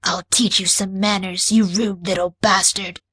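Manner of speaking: understated threat from the alien; cold threat without shouting; mild menace. Acted. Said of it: "she spat".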